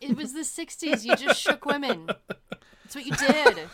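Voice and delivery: put-on a sixties film accent with a slight Woody Allen flavour. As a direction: ham up a fast-talking sixties movie accent. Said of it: "I don't know why I'm getting that accent".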